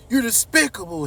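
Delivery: high, loud, disgusted; disgusted outburst. Retold or imitated. Son condemning his cheating father, high loud disgust. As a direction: spit out the insult.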